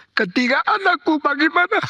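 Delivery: very high, strained appeal; anguished question. Retold or imitated. Dying father asking pastor about his children, anguished question. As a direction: cry out in worry for your children.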